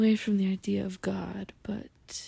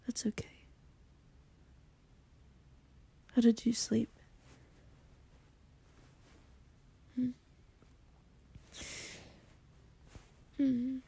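ASMR whisper. Acted. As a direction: whisper close and gentle to the listener. Soft-spoken ASMR morning roleplay.

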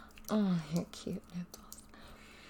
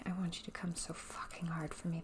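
usual speaking voice

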